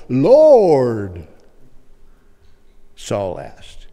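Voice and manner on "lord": loud, long-drawn, falling cry; respectful address. Acted. Saul addressing the heavenly voice, loud and reverent. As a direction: draw the title out in exaggerated deference. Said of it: "I'm gonna be respectful".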